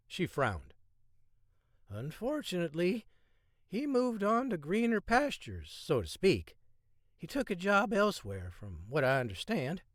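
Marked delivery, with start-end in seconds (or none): 1.9-9.8 high, coy receptionist voice; flirty woman's voice. A narrator's reading. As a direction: play coy, higher voice. Held receptionist voice.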